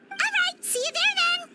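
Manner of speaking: cheery farewell. Acted. Cheery goodbye.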